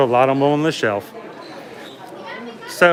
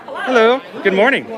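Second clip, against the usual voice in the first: cheery greeting; 7 semitones higher, 3 dB louder than usual. Real, not acted. Greeting characters loudly.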